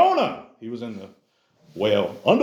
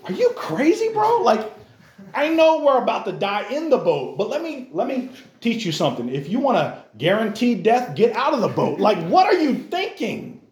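exasperated disciple; 8 semitones higher than usual. Retold or imitated. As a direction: scold a reckless friend in the boat. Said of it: "I'm like".

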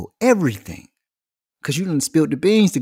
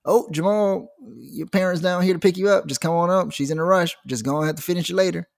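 higher, sing-song office announcement; office lady voice; 6 semitones higher than usual. Retold or imitated. Office lady calling Jamal out of class, higher sing-song. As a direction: sweetly call a kid out of class.

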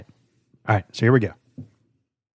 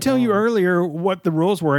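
ordinary talk, nothing marked

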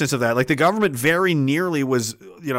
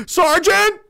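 high, loud gasping call to the sergeant; startled call; 5 dB louder than usual. Acted. Soldier suddenly calls out to his sergeant, startled. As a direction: gasp and call out as if caught. Acted gasp calling sergeant.

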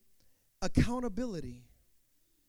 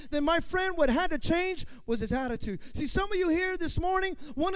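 louder, rising preacher's build; full-force preaching; 4 semitones higher, 6 dB louder than usual. Acted. Preacher to congregation, building to a loud peak. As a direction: drive the point home to the crowd.

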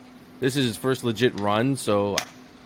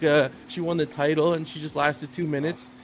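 ordinary talk, nothing marked

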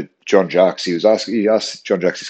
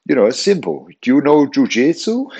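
put-on higher Brazilian accent, teasing question; playful Brazilian accent, higher; 7 semitones higher, 3 dB louder than usual. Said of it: "he's talking his Brazilian accent".

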